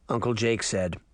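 usual speaking voice

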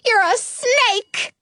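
high, drawn-out accusing screech; yelling pigeon. A narrator's reading. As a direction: stretch the accusation in a bird voice. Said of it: "the pigeon yells".

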